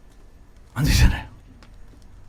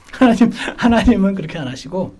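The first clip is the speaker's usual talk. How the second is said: ordinary talk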